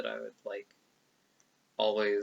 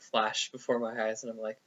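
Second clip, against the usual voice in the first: ordinary delivery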